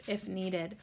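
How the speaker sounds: ordinary talk, nothing marked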